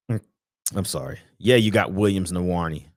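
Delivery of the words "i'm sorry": ordinary talk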